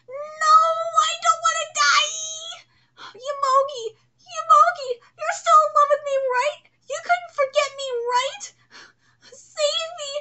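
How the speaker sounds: high, whispered pleading; hammed-up begging villain; terrified villain. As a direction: beg for your life. Villain begs Yomogi.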